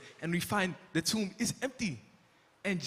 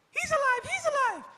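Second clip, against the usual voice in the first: very high, loud mock cries of news; yelling; 5 dB louder than usual. Mary running up with news, high excited yelling. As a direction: call out the good news breathlessly. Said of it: "I'm hearing".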